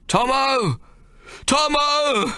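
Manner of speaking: high, calling a lost friend across the swamp; a hoarse searching shout; desperate shouting. Acted. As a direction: call his name over and over, pleading.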